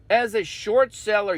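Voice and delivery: ordinary delivery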